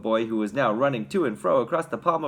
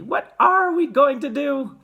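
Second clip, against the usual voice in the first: high, slow, loud lament; despairing mother's drawn-out question; wailing; 13 semitones higher, 8 dB louder, slower than usual. A narrator's reading. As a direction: wail it helplessly. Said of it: "wailed Missus T V".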